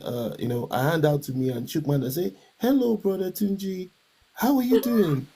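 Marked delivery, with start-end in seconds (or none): 2.6-5.3 high, bright formal greeting; wife's courteous stranger voice; polite stranger. Retold or imitated. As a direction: imitate his wife greeting him like an acquaintance. Wife formally greeting him.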